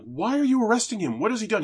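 woman protesting the arrest in a high alarmed voice. Retold or imitated. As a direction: demand answers in alarm.

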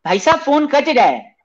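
put-on high, squeaky kid-like voice; childish prank voice. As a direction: play a silly little boy on the phone.

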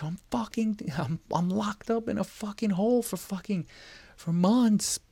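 high, strained mock pleading; desperate plea. Inmate writing home about being locked up, high desperate wail. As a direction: plead up at the top of your voice.

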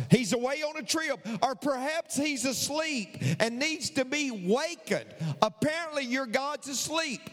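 high-pitched, rolling taunts; preacher's gleeful scoffing, pitched well up; mocking. A narrator's reading. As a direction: pile on the ridicule as Elijah. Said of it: "Elijah began to mock them".

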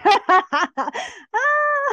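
high, loud peals of laughter; real laugh. Real, not acted. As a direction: laugh hard at your own joke. Laughing at own dismay.

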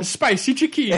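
put-on very high, laughing Italian accent; silly fake-Italian accent through laughter; mock Italian accent. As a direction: squeal it like a cartoon Italian. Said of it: "we've made fun of Italy".

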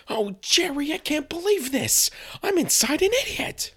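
very high, squeaky put-on George voice; George as the fox. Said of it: "the nine-tailed fox with the personality of George".

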